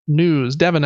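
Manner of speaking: ordinary delivery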